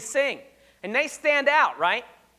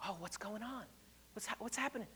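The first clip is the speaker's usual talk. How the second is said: hushed gossip; 22 dB quieter, faster than usual. Acted. Acted gossip between church ladies.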